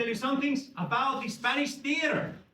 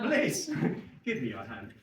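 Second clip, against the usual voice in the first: usual speaking voice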